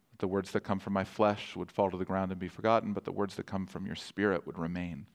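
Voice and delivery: soft, low opening prayer. Real, not acted. Pastor praying before the sermon, soft and low. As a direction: pray quietly over the congregation.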